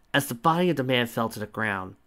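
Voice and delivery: ordinary delivery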